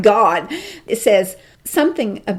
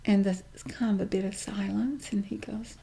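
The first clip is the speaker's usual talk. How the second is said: hushed storytelling whisper; 11 dB quieter than usual. Real, not acted. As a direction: drop to a whisper for the silence. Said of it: "whispered narration before apology".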